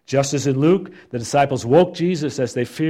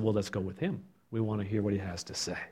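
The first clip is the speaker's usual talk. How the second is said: soft fishermen voice; 4 semitones lower, 11 dB quieter, faster than usual. Acted.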